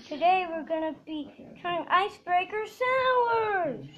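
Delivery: ordinary delivery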